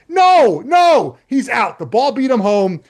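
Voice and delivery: two high, punched cries of objection; emphatic protest. Acted. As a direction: shout it down like a blown call. Rejecting an imagined umpire's call.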